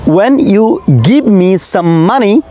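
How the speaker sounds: very slow, drawn out for the student to copy; slow prompt to repeat. Shown as a demonstration. Teacher leading a student in a repeat-after-me drill, very slow. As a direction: stretch each word so the learner can follow. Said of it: "try করো".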